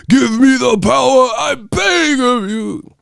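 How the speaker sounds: high, shouted mock incantation; spell climax. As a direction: cry out the spell, pleading for power. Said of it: "They doing the Child's Play spell".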